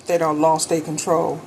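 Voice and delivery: usual speaking voice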